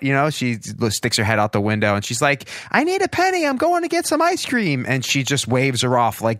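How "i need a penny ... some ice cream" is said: very high-pitched mock holler; imitated kid yelling. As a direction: play a child calling up to mom, pitched way up. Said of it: "hear her yelling for a penny".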